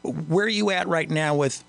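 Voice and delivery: ordinary talk, nothing marked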